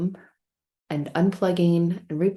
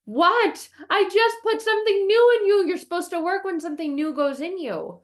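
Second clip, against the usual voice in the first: high, loud exasperated rant; hammed-up outrage, pitched way up; angry; 12 semitones higher, 5 dB louder than usual. Scolding the smoke alarm that ignores its new battery, angry. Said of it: "I'm getting angry, and I'm like".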